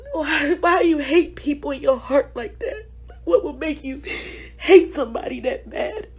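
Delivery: soft, tearful plea, voice breaking; hurt pregnant woman pleading through tears; real crying. Real, not acted. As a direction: ask through real sobs. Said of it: "I don't usually cry".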